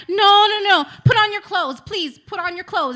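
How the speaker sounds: loud, high retold protest; panicked pleading. Woman begging naked date to dress, panicked pleading. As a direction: act out alarmed begging, pitch way up.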